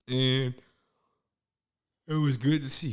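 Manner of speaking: words slurred by a numb jaw; numb mouth. Real, not acted. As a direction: talk through dental numbness. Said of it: "This numbing on my jaw is".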